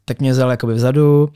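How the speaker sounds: ordinary talk, nothing marked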